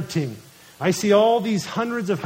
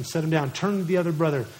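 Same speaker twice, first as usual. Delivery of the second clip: ordinary delivery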